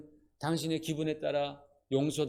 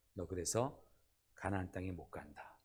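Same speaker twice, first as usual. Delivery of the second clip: low, much softer, icy final sentence; cold verdict; 8 semitones lower, 9 dB quieter than usual. Acted. God barring Moses from Canaan, cold and quiet. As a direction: hand down the verdict coldly and quietly. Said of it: "굉장히 하나님 냉정하시잖아요".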